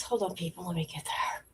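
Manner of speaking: whispered aside to herself. Real, not acted. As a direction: drop to a whisper while fiddling. Fiddling with the video controls.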